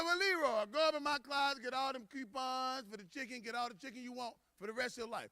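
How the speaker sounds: high, wailing boss; acted high-voiced executive placating employee; company president. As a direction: plead high, softening through the offer. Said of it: "The president walked over to Leroy".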